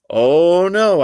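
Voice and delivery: high, loud horrified oh no; a boy refusing saddle shoes; loud protest. Acted. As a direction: recoil from the idea out loud.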